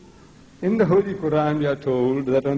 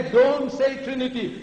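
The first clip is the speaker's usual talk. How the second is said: raised, emphatic order; firm warning; 5 semitones higher than usual. Shown as a demonstration. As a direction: warn someone off sharply. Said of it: "When you tell somebody".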